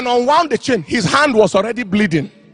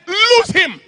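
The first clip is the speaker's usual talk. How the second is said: sharp high command to cast out; commanding shout; 7 semitones higher than usual. Retold or imitated. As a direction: bark it like an exorcist. Preacher commands demon in retold deliverance.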